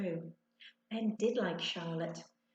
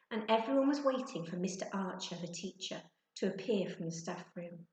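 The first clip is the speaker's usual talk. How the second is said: ordinary delivery, nothing marked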